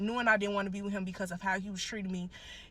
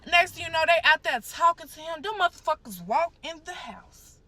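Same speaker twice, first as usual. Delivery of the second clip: raised, high-pitched, agitated telling; worked up; 7 semitones higher, 9 dB louder than usual. Real, not acted. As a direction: tell it loudly, getting frantic. Retelling police grabbing her.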